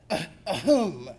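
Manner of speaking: awkward little ahem; throat clearing. A narrator's reading. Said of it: "Lenny cleared his throat".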